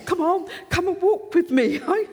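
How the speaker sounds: high, loud, joyful calling out; acted elation with bursts of laughter; overjoyed. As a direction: call the crowd along, bursting with joy. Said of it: "He is so full of joy".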